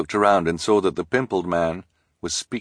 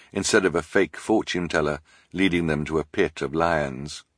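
ordinary delivery

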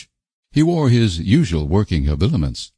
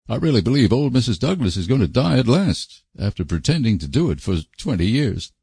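lively raised-pitch rustic gossip; higher, swinging intonation, blunt; blunt country messenger; 5 semitones higher than usual. Acted. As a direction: report it frankly as a country neighbour. Voices Alec, blunt messenger.

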